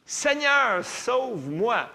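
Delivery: high, loud, slow plea for rescue; desperate cry. Retold or imitated. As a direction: wail it like a drowning man. Peter sinking, crying to the Lord. (cri désespéré, aigu et fort, lent)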